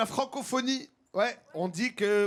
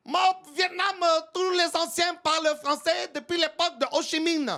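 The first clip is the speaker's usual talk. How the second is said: put-on Vietnamese accent; 8 semitones higher than usual. Impersonates a Vietnamese speaker.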